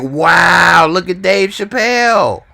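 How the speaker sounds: high, loud mock astonishment; amazed reaction. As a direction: act out a stunned viewer's wow. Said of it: "You'll be like".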